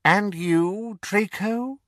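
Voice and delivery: high, cold, mocking villain voice; cold dark lord. Dark lord mocking the Death Eaters, cold and sneering. Voldemort asks Draco.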